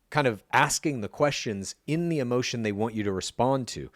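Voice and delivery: usual speaking voice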